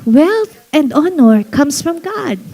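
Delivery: slow, deliberate scripture reading. Shown as a demonstration. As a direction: read each word slowly to the congregation. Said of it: "basahin uli natin". (mabagal na pagbasa)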